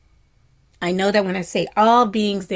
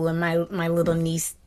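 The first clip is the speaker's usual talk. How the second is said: normal talking voice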